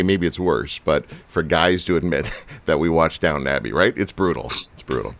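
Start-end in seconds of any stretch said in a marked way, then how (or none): 4.5-5.1 lower, softer put-on voice; mock deep voice. Host echoing his own joke to Martin, mock-grave low voice.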